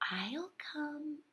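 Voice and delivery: tiny breathless whisper; a small shy creature whispering. A narrator's reading. Small sea anemone answering the crab in a whisper. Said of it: "whispered a small sea anemone".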